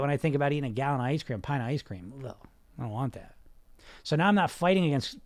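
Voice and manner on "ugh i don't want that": disgusted. Pretend. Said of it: "That's the feeling I get".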